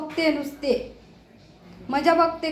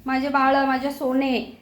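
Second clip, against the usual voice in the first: normal talking voice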